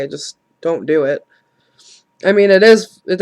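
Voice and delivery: ordinary delivery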